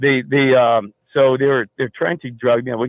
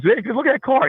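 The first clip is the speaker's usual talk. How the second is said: much higher-pitched, urgent imitation; retold alarmed warning; 13 semitones higher than usual. Roommate warning the teller about a car outside, mock alarm. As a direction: point out danger in a raised pitch.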